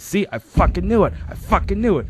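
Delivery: loud, high-pitched gloating imitation; mock triumphant outburst. Husband turns to his wife, mock triumphant. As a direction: crow it high and loud, punching each repeat.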